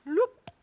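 high squeaky bloop; a quick high comic bloop; silly vision noise. Pretend. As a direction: pop it out like a cartoon noise. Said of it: "that's the noise that happens".

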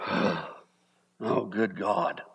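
drawn-out, put-upon grumble; whiny complaint. Shown as a demonstration. Pastor demonstrating a whiner meeting problems, grumbling. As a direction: complain it as if wronged. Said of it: "If you say it like that".

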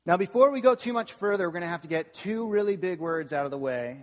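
ordinary talk

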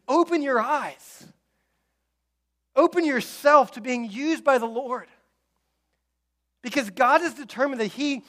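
rally the church to open their eyes; preaching at full force. Acted. Rising sermon exhortation.

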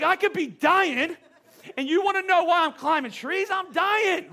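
high, loud, hammed-up outrage; indignant panicked yelling. Stung husband answering wife's question about trees, indignant yelling. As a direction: yell in comic disbelief at the question. Acting out indignant reply to wife.

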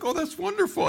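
very high, light motherly voice; imitated mom, way up in pitch; delighted mom. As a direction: coo it like a pleased mother. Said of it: "my mom's like".

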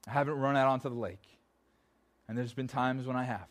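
ordinary delivery

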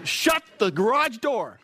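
high sassy drawn-out put-down; with attitude. Shown as a demonstration. Preacher shows the sassy way to shut down gossip, high and drawn-out. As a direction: give it full sassy attitude. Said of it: "practice that phrase".

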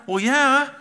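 put-on high, loud kid's reply; matter-of-fact child. Son answers dad about the speed, imitated obviousness. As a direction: mimic a boy stating the obvious.

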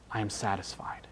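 a hushed whisper of quiet content. Acted. Preacher voicing the psalmist, whispering his contentment. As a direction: breathe it out, barely voiced.

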